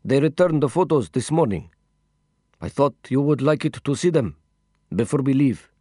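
put-on lilting, accented storytelling companion; accented character voice; foreign-accented companion. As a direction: explain it earnestly in accented English. Voices Mahmoud in dialogue.

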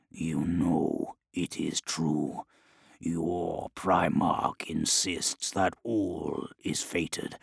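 low, slow, hushed demonic voice; a slow, quiet demon. Acted. Demon prophesies to Scrivok.

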